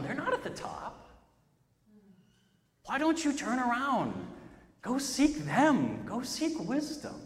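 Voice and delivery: gentle inner voice. Retold or imitated. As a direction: speak like a tender inner voice. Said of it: "I hear the voice".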